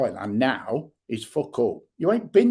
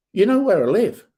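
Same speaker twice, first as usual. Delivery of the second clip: higher and louder, daring him; defiant shout; 9 semitones higher, 6 dB louder than usual. Real, not acted. Vlogger daring Chef to come, defiant shout. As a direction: throw it in his face.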